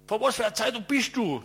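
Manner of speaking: loud, high, aggressive demand; snapping like a wolf. Retold or imitated. Angry boss on the phone grilling the photographer, loud and high. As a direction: snap it like a biting wolf. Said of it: "wie ein Beißwolf".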